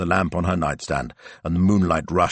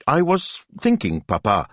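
high, tentative daughter voice; lightly pitched-up, feigned nonchalance; carefully casual; 7 semitones higher than usual. A narrator's reading. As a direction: float it to papa with studied casualness. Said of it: "her voice carefully casual".

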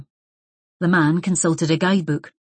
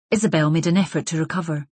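plain reading voice

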